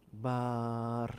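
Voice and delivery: "Bar" with a stretched vowel at the end; wrong long ending. Shown as a demonstration. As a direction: drag out "Bar" as the mistake. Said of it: "on s'arrête sur le B".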